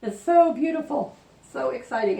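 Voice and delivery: high, loud burst of giddy excitement; real excitement. Real, not acted. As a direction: gush excitedly, pitch and volume climbing. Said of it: "I'm so excited about this".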